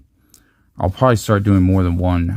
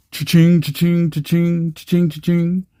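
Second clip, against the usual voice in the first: high sing-song cha-ching, much higher; cash register cheer; 9 semitones higher than usual. Real, not acted. Opening trading cards, chanting a gleeful cash-register cha-ching. Pulling a valuable card.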